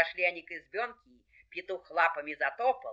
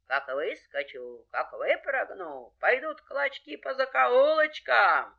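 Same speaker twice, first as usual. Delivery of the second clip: slow, drawn-out threat, rising at the end; threatening fox; 4 semitones higher than usual. Acted. Fox scares off the dogs, menacing. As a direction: stretch each menace out, building to the last.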